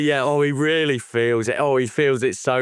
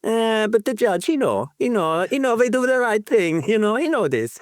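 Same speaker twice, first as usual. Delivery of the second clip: mock Italian accent, higher and quick; 10 semitones higher than usual. Podcaster quoting Italian players in a mock Italian accent. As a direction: play an Italian fan shrugging it off in an Italian accent. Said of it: "that Italian accent".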